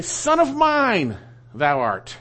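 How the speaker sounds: SON OF MINE drawn out high, then THOU ART dropping; emphatic SON. Shown as a demonstration. Preacher showing the congregation the wording's emphasis, emphatic on SON. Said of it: "It's emphatic with the word son".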